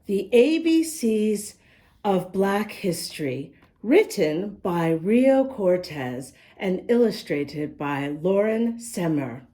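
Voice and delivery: slow, clear storybook reading; reading to children. Acted. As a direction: read the rhymes to little listeners. Reads the picture book aloud.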